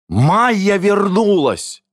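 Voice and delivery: high, drawn-out exclamation; excited announcement. A narrator's reading. Announcing the missing woman's return. (взволнованно, высоко и протяжно)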